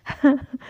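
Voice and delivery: short real burst of laughter; a quick high giggle at her own story; laughing. Real, not acted. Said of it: "मुझे बहुत हंसी आ रही है". (हल्की सी असली हंसी)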